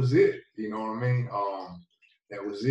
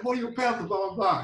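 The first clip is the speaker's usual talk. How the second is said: higher, thrilled mock cheer from family; excited family cheer; 10 semitones higher than usual. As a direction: imitate family celebrating the pick. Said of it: "They're like".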